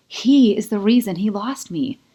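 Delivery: defiant, heated resolve; rising anger. A narrator's reading. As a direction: she refuses to back down.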